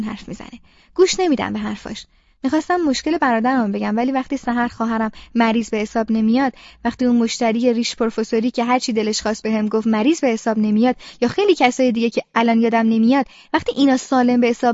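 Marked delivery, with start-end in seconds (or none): none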